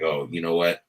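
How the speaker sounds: usual speaking voice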